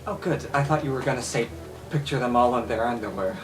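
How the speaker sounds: ordinary delivery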